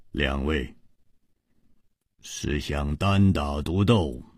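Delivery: low, slow, soft disdain; coolly taunting villain. A narrator's reading. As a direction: take your time, quietly belittle them. Cold villain sizing up two opponents. (低沉缓慢，冷冷地问)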